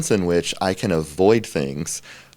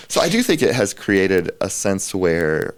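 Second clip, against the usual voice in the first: usual speaking voice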